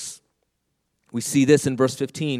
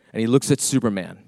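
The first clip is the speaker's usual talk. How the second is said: usual speaking voice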